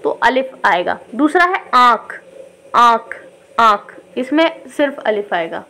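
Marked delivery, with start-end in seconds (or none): 1.7-4.0 आँख with a long nasal आँ; long aa demo. Shown as a demonstration. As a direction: draw out the आँ in आँख. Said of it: "आँख में बड़ी आवाज़ आ रही है". (आँख में लंबा आँ)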